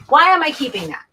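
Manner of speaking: why on earth am I keeping this; catching herself mid-sort, amazed; baffled at herself. Real, not acted. Questioning her own clutter.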